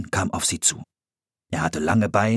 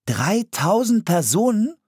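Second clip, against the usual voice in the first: high-pitched amazed question; wide-eyed disbelief, pitched way up; astonished; 4 dB louder than usual. A narrator's reading. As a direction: marvel at it in a boy's voice. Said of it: "staunte Philipp".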